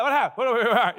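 high, rapid-fire mock alarm; startled panic. Preacher mimicking a jumpy older person, startled panic. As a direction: jump in, flustered and high-pitched.

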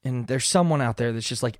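normal talking voice

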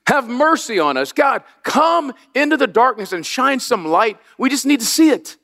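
pleading prayer. Retold or imitated. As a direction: beg for mercy, voice lifted and slowed. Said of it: "'cause it, it's like".

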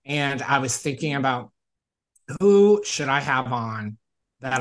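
loud, upbeat show-host delivery; podcast host intro. Acted. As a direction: pump up the welcome like a host. Podcast host opening the episode.

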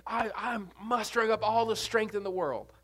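strained, effortful voice of a striving servant; mock earnest striver; straining servant. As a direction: push the words out like you're trying too hard. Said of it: "go by it by Gehazi".

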